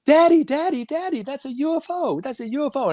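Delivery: very high imitated child voice; excited little boy. Young nephew telling Daddy about a UFO, excited child. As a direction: squeal it like a kid tugging at a parent.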